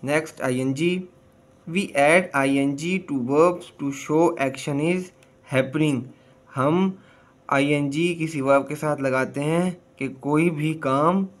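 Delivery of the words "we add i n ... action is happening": slow reading. Shown as a demonstration. Teacher reads English rule slowly.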